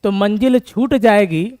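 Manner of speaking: loud, slow declaiming to spur students on; motivational recital. Shown as a demonstration. Teacher urges students not to quit, declaiming loud and slow. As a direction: proclaim each line slowly and loudly, like a rallying poem. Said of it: "ध्यान सुनिएगा".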